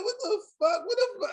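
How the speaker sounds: high, laughing what-the-fuck; laughing his disbelief to grandma. Real, not acted. Retelling disbelief while laughing.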